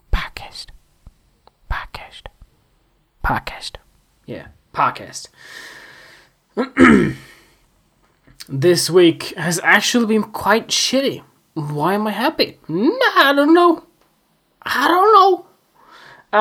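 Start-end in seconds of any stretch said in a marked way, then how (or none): 0.1-3.7 soft unvoiced whisper of "Podcast"; whispered repeat. Real, not acted. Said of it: "Did I just say podcast?". 12.7-16.4 much higher, light "I don't know"; high shrug. Pretend. Mock shrug about happiness.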